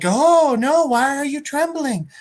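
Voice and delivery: lightly voiced goddess. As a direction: imitate an otherworldly being addressing a victim. Spider god addresses Peter in vision.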